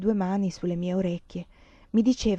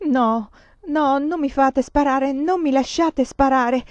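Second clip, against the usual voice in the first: higher, pleading cry of panic; terrified cry; 6 semitones higher, 3 dB louder, slower than usual. A narrator's reading. Countess begs not to be made to shoot, terrified cry. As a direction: beg in rising terror, voice pitched high. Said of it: "gridavo io incoerente e rigida di terrore".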